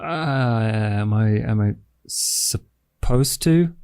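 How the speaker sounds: ordinary delivery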